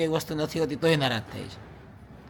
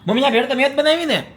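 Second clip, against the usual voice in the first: louder, higher call toward mom; 9 semitones higher, 8 dB louder than usual. Real, not acted. Son calling mom to tease his wife, loud call. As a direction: call to mom across the house.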